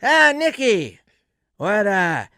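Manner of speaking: loud, high cheerful Norm; imitating Norm's sing-song hello; Norm greeting. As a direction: greet Nicky with mock delight. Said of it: "He goes".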